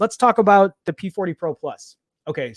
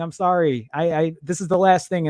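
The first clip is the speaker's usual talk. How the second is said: ordinary talk, nothing marked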